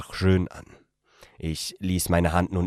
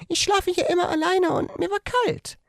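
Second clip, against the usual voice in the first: much higher female character voice; young woman's voice. A narrator's reading. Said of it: "als sie meinte".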